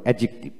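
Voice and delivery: অ্যাডজেক্টিভ run together as "a-jik-tiv"; silent d. Shown as a demonstration. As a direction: say অ্যাডজেক্টিভ with no d before the j. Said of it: "এভাবে উচ্চারণ করবে". (ডি ছাড়া অ্যাডজেক্টিভ উচ্চারণ)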